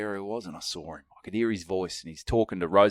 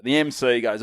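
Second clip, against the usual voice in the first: ordinary delivery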